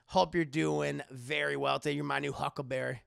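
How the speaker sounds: ordinary talk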